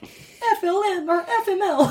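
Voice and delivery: high, slow, drawn-out shout of despair; exasperated shout. Pretend. Navy lad on the doomed ship, despairing high shout. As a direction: yell it like a doomed sailor giving up. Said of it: "You'd be like".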